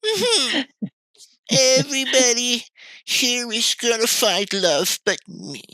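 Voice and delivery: very high, slow whiny self-pity; mock self-pity. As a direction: whimper it like a sad lonely loser. Self-pitying inner voice, mocked.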